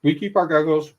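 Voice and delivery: throat still catching; strained, half-choked restart; choking. Real, not acted. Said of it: "I can't drink any honey".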